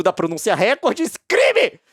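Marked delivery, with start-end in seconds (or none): 1.3-1.8 much higher, louder mock cry; mock outrage. Mocking the lawsuit's charge for viewers. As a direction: ham up the accusation.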